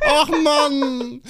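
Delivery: high, drawn-out whine; hammed-up tearful groan; mock whining. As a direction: wail it like a hurt child. Acts being hurt.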